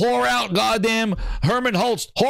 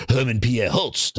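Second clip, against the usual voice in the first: ordinary talk, nothing marked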